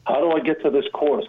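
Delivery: ordinary talk, nothing marked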